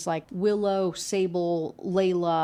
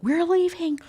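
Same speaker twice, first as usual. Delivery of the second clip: acted angry demand; 10 semitones higher, slower than usual. Girlfriend threatening him with a knife, acted angry yell.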